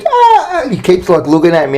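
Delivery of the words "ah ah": much higher laughing screams of riders; mock screeching from the ride; imitated screech. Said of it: "you could hear the screech".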